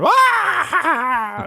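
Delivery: high, loud cackling guffaw; gremlin's belly laugh. Retold or imitated. The humor gremlin watching Mallrats, a loud high cackle. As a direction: howl with laughter like a delighted gremlin. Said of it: "that little gremlin is going".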